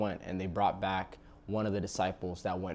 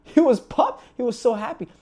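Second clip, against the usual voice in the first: much higher and louder with excitement; pumped; 11 dB louder than usual. Acted. Preacher telling of the father's joy, shouting excitedly. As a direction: shout it out like the best news ever.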